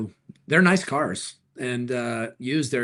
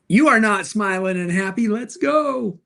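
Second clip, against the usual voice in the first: sudden high, loud burst of cheer; upbeat hype; 8 semitones higher, 6 dB louder than usual. Acted. Urging a serious-looking creator to cheer up, upbeat hype. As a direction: jump up in pitch and volume like a hype man. Breaks calming imitation to urge cheer.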